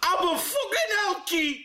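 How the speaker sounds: high, drawn-out wailing Geordie parody; Ant. Acted. Player lampooning a TV host, high mock wail. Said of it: "That's my aunt".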